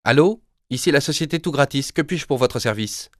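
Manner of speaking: a polished telephone operator voice; company phone voice. Acted. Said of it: "la même voix que la veille".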